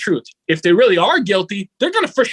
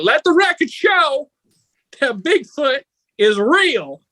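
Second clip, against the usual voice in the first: mock judge; 7 semitones higher, slower than usual. Mock judge ruling on Bigfoot.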